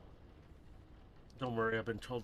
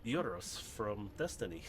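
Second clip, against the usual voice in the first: Euros from Destiny; 5 semitones higher than usual. Pretend. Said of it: "now you hear this voice".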